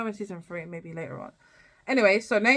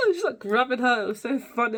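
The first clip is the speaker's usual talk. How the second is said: ordinary talk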